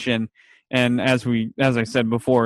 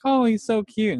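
a much higher, cooing lady voice; gushing receptionist; 9 semitones higher than usual. Retold or imitated. Receptionist cooing over Five, in a much higher gushing voice. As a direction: coo it like she's melting over a cute kid.

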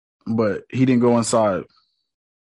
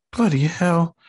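light British exclamation, higher; mock British; 5 semitones higher, 3 dB quieter, slower than usual. Imitating Steven waking up lost, in a light British accent. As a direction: mimic Steven's British gasp.

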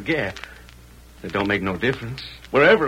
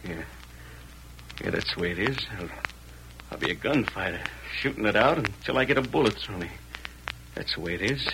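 much lower and softer, resigned; quiet fatalism; grim resignation; 6 semitones lower, 9 dB quieter than usual. Acted.

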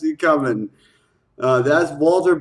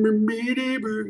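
high sung guitar noises, dropping off softer; 5 semitones higher, 5 dB quieter than usual. Shown as a demonstration. Showing how he does guitar solos by voice, guitar imitation. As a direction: imitate guitar licks with your mouth.

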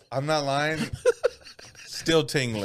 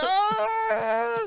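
long high-pitched wail; hammed-up sustained wail; mock wail. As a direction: let out a drawn-out, very high mock cry. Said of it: "And I was like".

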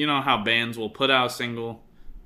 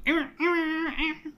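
higher guitar noise; slower than usual. Shown as a demonstration. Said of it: "two different noises".

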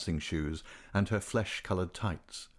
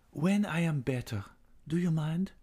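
higher, lightly unsteady woman's voice; shaking voice; 8 semitones higher than usual. A narrator's reading. Singer putting off the young man, mildly tremulous. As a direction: ask with a touch of a tremble. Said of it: "her voice shook".

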